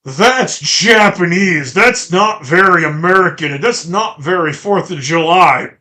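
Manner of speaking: emphatic sing-song mockery of a gripe; mocking complainer. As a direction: imitate an outraged commenter, hammed-up. Mocking commenters' complaint in acted quote.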